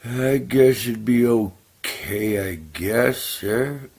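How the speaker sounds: the gullible raccoon agreeing reluctantly; hesitant raccoon. Acted. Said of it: "He goes".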